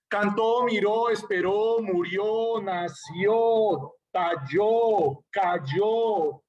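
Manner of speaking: each verb ends on a long, forceful ó, as in "Cantó"; stress on final O. Shown as a demonstration. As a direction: stretch the stressed ó of "miró", "esperó", "murió". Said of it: "Es la fuerza en la O".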